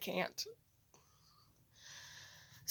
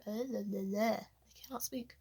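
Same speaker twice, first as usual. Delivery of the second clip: whisper; 7 dB quieter, much slower than usual. Real, not acted. Whispered aside mid-story.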